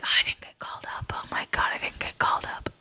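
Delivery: mock whisper of a left-out kid; whispered kid's sulk. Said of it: "They're like".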